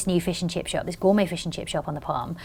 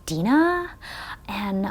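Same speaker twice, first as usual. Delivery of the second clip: put-on American accent; 2 semitones higher, 4 dB quieter than usual. Nervous caller ordering a secret special, fake American accent. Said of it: "I put on this accent".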